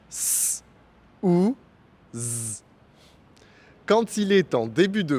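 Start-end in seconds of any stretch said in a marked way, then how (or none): 0.1-0.6 "S" as a voiceless hiss; S sound. Shown as a demonstration. Teacher showing first way to pronounce S, soft hiss. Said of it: "deux manières de se prononcer". 2.2-2.6 "Z" as a voiced buzz; Z sound. Shown as a demonstration. Teacher showing second way to pronounce S, soft buzz. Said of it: "deux manières de se prononcer".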